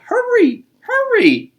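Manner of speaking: very high, loud urgent grandma call. Acted. Grandmother urging the squirrel home, very high loud call. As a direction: shout for him to run inside. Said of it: "she called".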